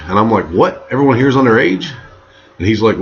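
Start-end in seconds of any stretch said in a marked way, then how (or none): 0.4-1.9 quick, high incredulous question; shocked disbelief. Retold or imitated. Storyteller learning the guests are underage, acted disbelief. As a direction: react with acted alarm, voice jumping up. Retold shocked reaction to the news.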